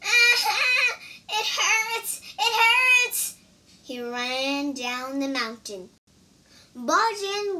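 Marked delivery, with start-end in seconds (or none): 0.0-3.3 high, loud pained wail; crying in pain. A narrator's reading. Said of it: "cried the monkey, grabbing his head".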